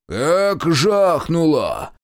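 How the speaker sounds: high, drawn-out holler; deafened yelling. A narrator's reading. Shestakov deafened by the bomb blast, slow high yelling. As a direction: bellow it like your ears are ringing. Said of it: "заорал Шестаков".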